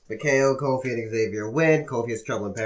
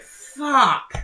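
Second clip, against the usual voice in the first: much higher, louder despairing curse; mock dismay; 12 semitones higher, 5 dB louder than usual. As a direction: cry it out like your night is ruined. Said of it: "And I was like".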